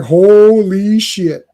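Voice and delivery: very slow, drawn-out disbelief; awestruck exclamation. Retold or imitated. Fan voicing onlookers to the fight, mock astonishment. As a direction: stretch each syllable, loudly astonished.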